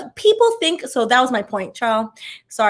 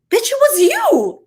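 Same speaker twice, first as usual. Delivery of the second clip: high, slow, biting comeback; imagined retort; 12 semitones higher, 4 dB louder, slower than usual. Pretend. To the boss who blamed her, a high mock retort. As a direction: snap it at her like you finally can. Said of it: "Excuse my language".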